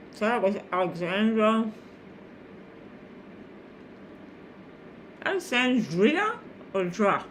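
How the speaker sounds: talking with a mouth full of food. Real, not acted. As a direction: mouth full, words round a bite. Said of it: "I'm talking with my mouth full again".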